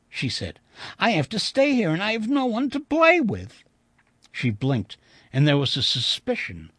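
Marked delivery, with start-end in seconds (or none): 1.0-3.6 put-on high, childlike, plaintive voice; lonely little girl. A narrator's reading. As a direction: complain like a lonely small child. Little ghost girl's voice.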